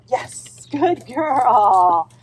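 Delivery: loud, high cheering praise; jubilant praise. Real, not acted. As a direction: celebrate her success. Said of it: "feel happy and proud for her".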